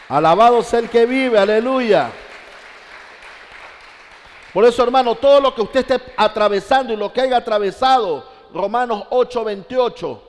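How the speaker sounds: loud, high-pitched cry of praise; full-force preaching. Real, not acted. Preacher rousing the congregation with praise, full-force preaching. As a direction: preach it out loud to the whole church.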